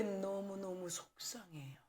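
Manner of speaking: upset whisper. Real, not acted. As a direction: let the hurt drop to a whisper. Pastor confesses grief at baptism refusal. (속상한 마음을 천천히 속삭이듯)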